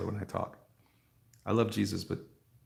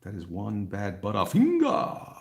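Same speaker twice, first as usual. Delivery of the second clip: slow quip; 7 dB louder, slower than usual. Retold or imitated. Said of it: "I used to say".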